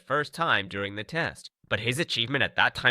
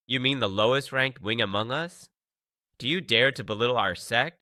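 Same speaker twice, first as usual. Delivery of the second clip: usual speaking voice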